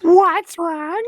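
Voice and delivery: high-pitched cartoon character voice; Carl. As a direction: put on a high, silly character voice. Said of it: "do a pretty good Carl impression".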